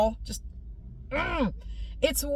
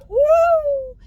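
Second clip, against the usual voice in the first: much higher, loud whoop; woo of pain; 10 dB louder than usual. Retold or imitated. Teller warning listeners about nerve pain, loud whoop. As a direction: let out a high yelp.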